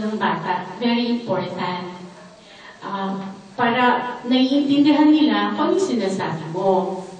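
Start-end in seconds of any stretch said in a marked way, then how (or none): none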